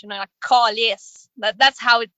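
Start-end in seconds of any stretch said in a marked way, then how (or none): 0.4-1.3 "calice" with a broad, rounded Quebec A; Quebecois accent. Shown as a demonstration. Showing how it feels like a swear word, Quebecois accent. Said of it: "the, the accent".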